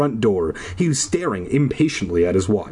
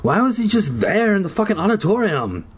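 high, insistent character voice; hammed-up indignant excitement; worked-up questioning; 8 semitones higher than usual. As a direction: demand an answer, pitched up. Zeke's heated questioning, acted.

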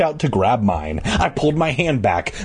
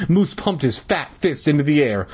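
plain reading voice

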